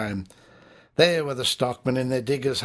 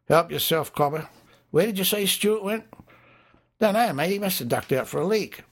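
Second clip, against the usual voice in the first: higher, easygoing bar mate; pub drinker; 8 semitones higher than usual. Acted. One drinker offering a smoke to a mate, higher and casual. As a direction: answer offhand with a shrug.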